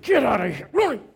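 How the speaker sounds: loud, rising shout ending high; urgent command. Retold or imitated. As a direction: shoo the dog away, sharp and urgent. Ordering dog to flee.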